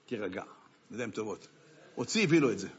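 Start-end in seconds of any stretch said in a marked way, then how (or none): 0.1-1.5 soft, low reassurance; quiet soothing to a distraught man; calming softly. Retold or imitated. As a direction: hush him gently, much softer. Rabbi reassures the gentile.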